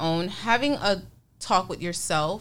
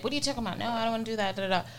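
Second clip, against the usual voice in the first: emotional; 4 semitones higher, much faster than usual. Retold or imitated. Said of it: "She was emotional".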